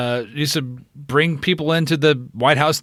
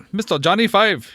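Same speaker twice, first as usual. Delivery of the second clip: a hint of an Indian accent, raised pitch; quick mock Indian accent line; 9 semitones higher than usual. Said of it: "that was my impression".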